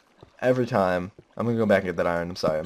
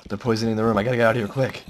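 ordinary talk, nothing marked

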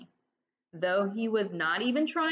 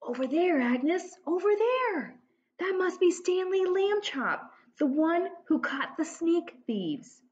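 whispering townsfolk; 9 semitones higher than usual. Acted. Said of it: "He could hear them whisper".

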